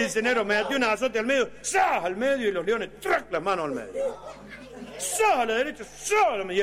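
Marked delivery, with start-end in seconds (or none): 1.6-2.0 high sharp zas of a whip; cracking whip. Acted. 3.0-3.3 high clipped trac noise; clattering sound effect; sharp trac. Acted. Sound of lions moving. 5.0-5.4 high sharp zas of a whip; cracking whip. Acted.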